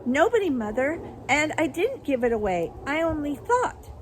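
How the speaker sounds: high, loud anxious protest; frightened daughter. A narrator's reading. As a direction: plead your innocence to Mother.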